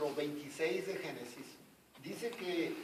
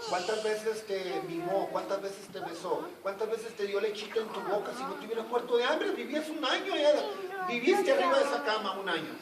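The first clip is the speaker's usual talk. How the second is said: rising preaching fervor; 5 semitones higher, 6 dB louder than usual. Acted. Preaching rebuke builds.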